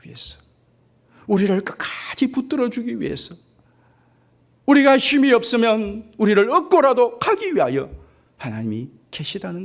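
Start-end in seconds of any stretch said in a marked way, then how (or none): 1.3-7.9 preaching. Acted. Preaching peak.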